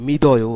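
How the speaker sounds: loud মিদয় with d joined to the vowel; মিদয়, d carried into o; linked voiced ending. Shown as a demonstration. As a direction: punch out মিদয় as one word, voiced d. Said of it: "এভাবে উচ্চারণ হলো".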